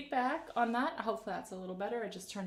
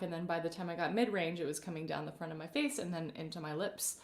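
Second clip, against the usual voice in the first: normal talking voice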